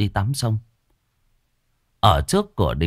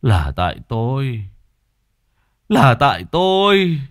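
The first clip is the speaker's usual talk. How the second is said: put-on bawling like a child; 9 semitones higher, 6 dB louder, slower than usual. Husband blaming himself at his wife's feet, wailing loudly. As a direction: wail building to high, loud, drawn-out cries. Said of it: "Lão òa khóc như đứa trẻ".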